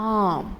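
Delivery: "arm" as a long open ah with no R sound; arm without R. Shown as a demonstration. Teacher to students: "arm" as aam with no R. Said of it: "the actual pronunciation is".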